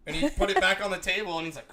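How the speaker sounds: normal talking voice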